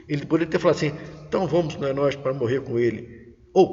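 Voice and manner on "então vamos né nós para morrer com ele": subdued, unhurried going-along; resigned intonation. Shown as a demonstration. Disciple Tomé agreeing to go and die with Jesus, resigned. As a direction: say it as reluctant, low-key agreement. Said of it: "não temos a entonação do texto".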